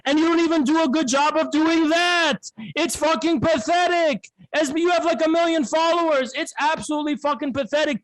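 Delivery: high, scornful, furious tirade; contemptuous rant. Real, not acted. Mocking the rival streamer's follower count, high scornful anger. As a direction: spit it out with disgust. Heated insult tirade, pitch raised.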